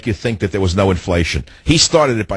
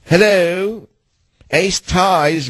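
high, prim British phone greeting; British receptionist accent; 10 semitones higher, much slower than usual. Retold or imitated. Host imitating a British receptionist answering the phone, British accent. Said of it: "a woman with a British accent".

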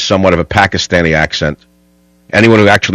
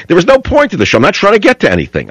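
same line pitched way up, stressing point; much higher restatement; 10 semitones higher, faster than usual. Real, not acted. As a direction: lift the voice high and hit point. Host restates the theme, pitched up.

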